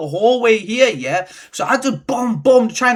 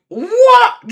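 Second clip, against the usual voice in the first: shocked what; 14 semitones higher, 7 dB louder than usual. Retold or imitated. Shocked by drill at his door.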